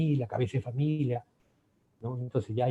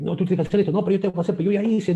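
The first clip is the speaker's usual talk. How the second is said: raised, higher bickering; mock couple argument; 7 semitones higher, 7 dB louder, much faster than usual. Couple quarrelling over chores, louder and higher. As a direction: argue back and forth, heated. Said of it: "entramos a un conflicto".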